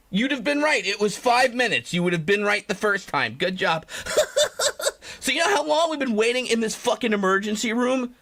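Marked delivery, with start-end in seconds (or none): 3.9-5.1 high burst of laughter. Real, not acted. Laughs after praising viewer.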